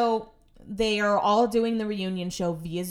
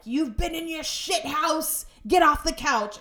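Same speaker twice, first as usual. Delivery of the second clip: high, mock-angry telling-off; drawn-out scold into a loud order; 8 semitones higher, 3 dB louder, slower than usual. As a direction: shoo the cat with a raised voice. [name] scolding cat off couch.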